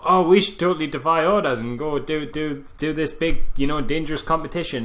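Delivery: lightly voiced hotshot egging on his mate; mock reckless enthusiasm.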